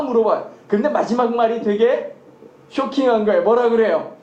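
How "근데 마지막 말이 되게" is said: normal talking voice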